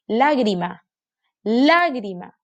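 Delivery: "Lágrima" said LÁ-gri-ma; stress on first syllable. Shown as a demonstration. Teacher helps children find the tonic syllable, "Lágrima" with LÁ stressed. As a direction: lean hard on LÁ in "Lágrima". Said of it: "Aquí está su sílaba tónica".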